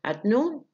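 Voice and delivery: noon rises high; unfinished rise. Shown as a demonstration. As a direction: leave noon hanging up. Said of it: "أنا ما خلصتش كلامي". (noon صاعدة كأن الكلام ما خلصش)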